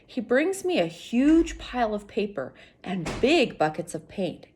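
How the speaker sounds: ordinary delivery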